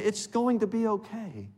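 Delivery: high, soft soothing voice; loving reassurance. Acted. Parent comforting a child scared of the dark, high soft reassurance. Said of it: "We love them. We say".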